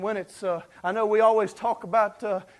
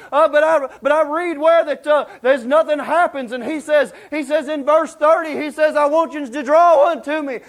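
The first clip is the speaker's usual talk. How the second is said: loud, high, driving sermon voice; fiery preaching; 8 semitones higher, 10 dB louder than usual. Acted. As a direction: preach it at full force to the congregation. Preaching at full force.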